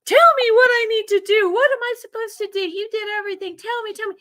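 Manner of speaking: very high re-enacted plea, loud at first, then fading; desperate pleading. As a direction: plead upward for answers, starting loud and dropping off. Acts out pleading with late husband.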